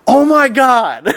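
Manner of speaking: high, laughing oh my God; laughing exclamation. Retold or imitated. Woman behind him cracking up at the bird, laughing exclamation. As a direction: burst out laughing as you say it. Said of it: "just starts cracking up, laughing".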